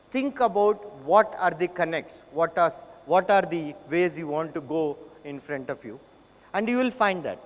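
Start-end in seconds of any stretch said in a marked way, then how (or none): none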